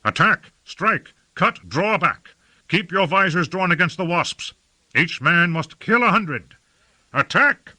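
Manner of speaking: high-pitched shouted war orders; rousing commander's calls in combat; battle commands. A narrator's reading. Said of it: "called Lord Fayed".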